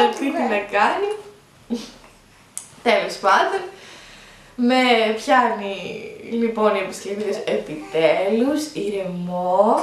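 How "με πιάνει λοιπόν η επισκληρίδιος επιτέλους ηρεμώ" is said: slow relief. Retold or imitated. Epidural finally works, relief.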